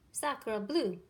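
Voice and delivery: duck crying out with a touch of French; lightly French duck voice; French exclamation. A narrator's reading. Duck finds the nest, cries out in French accent. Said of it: "she cried".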